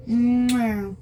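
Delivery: ordinary talk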